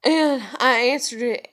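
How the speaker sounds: ordinary talk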